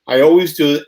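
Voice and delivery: ordinary talk, nothing marked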